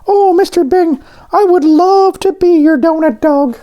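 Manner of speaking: squeaky high cartoon voice; high donut voice. A narrator's reading. Voices Arnie.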